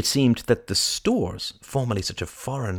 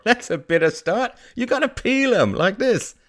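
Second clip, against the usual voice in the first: high-pitched, animated market seller; old vendor, exclaiming; 14 semitones higher, 4 dB louder than usual. Acted. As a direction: warn her with lively, pitched-up urgency. Vendor stops her biting unpeeled fruit.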